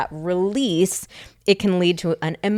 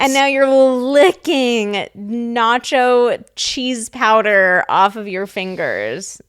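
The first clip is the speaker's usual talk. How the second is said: grossed out; 4 semitones higher, 4 dB louder, slower than usual. Retold or imitated. Retelling partner's gross habit.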